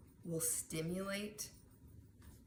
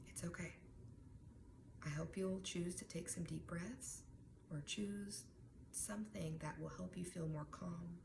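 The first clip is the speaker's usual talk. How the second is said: softer, gently reassuring; calm, soft teacher; 7 dB quieter than usual. Pretend. Teacher calmly comforts a student without homework, soft and gentle. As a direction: soothe him, soft and unhurried. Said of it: "Missus Peter is calm".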